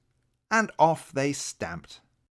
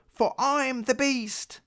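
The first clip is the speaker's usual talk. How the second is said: mock roar; 12 semitones higher, slower than usual. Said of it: "he roared".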